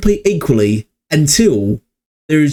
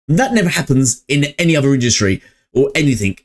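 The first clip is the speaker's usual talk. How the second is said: ordinary delivery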